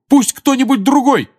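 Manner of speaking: urgent shout. Acted. Harry urging someone else to act.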